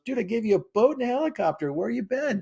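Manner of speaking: high, casual mock God voice; God answering. God teasing the drowned man, lightly mocking. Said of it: "And God goes".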